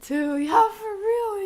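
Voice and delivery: loud, high and drawn out; high excited squeal. Real, not acted. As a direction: squeal it in disbelief. Shocked by the freezer scene.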